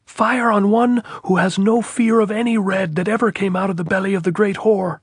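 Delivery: a long, high, loud defiant tirade; officer shouting a challenge uphill. A narrator's reading. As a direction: hurl the whole sentence up the hill. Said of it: "quite a long sentence to shout".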